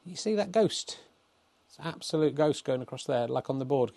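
ordinary talk, nothing marked

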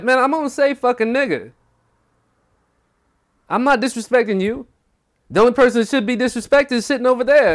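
high, loud imitation of arguing; heated retort. Mondo arguing back as he is kicked out, heated. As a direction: replay the quarrel with voice pushed way up.

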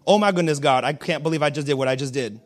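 low-pitched mock remorse; repentant. Sinner to God after breaking promises, mock repentant. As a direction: sink the voice into hammed-up regret. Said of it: "we are so repentant".

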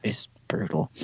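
muttered groan. Retold or imitated. Retold groan at the episode.

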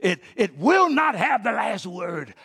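high, loud, driving sermon delivery; impassioned preaching. Real, not acted.

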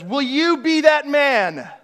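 very slow, high called question; calling up. Retold or imitated. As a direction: draw out the challenge upward. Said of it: "Charles looks up and says".

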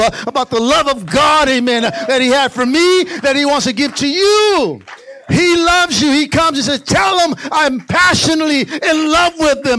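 loud, high preacher's climb; full-force preaching. Acted. Pastor preaching passion to the church, at full force. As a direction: preach it to the rafters.

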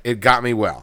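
ordinary delivery

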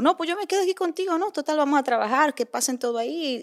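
a light take on the chivalrous friend; friend's gallant offer. Retold or imitated. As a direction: play the polite friend, lightly voiced. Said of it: "me dice mi amigo".

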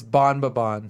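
Bon Babon said as bon-bah-BON; Bon Babon pronunciation. Shown as a demonstration. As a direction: say Bon Babon clearly, three syllables. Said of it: "How are people pronouncing B M B B N".

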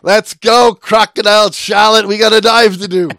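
high, loud hammed-up Australian buddy voice; mock Australian accent. Buddies calling her back to the boat, mock Australian accent. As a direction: ham up a cheery Aussie dive buddy. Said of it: "So they're like".

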